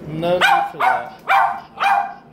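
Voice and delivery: drawn-out high sing-song telling-off; sing-song scolding. Real, not acted. Owner gently scolds the barking dog in high slow sing-song.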